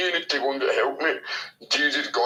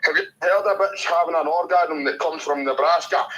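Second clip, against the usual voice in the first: normal talking voice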